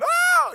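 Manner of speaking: very high, loud howl of pain; hammed-up yell from a bee sting; mock pain scream. You know, hurting.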